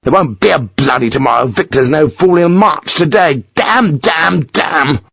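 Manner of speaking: furious rant. Acted. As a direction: rage at the lost chance. Lawford ranting.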